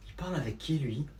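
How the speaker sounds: low, hushed murmur; puzzled aside. Acted. Eavesdropper puzzled by the boy's chatter, low hushed aside. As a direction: wonder to yourself unheard.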